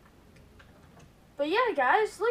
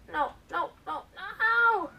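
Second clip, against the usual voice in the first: surprised protest; 6 semitones higher, 4 dB louder, slower than usual. Real, not acted. Something goes wrong in game.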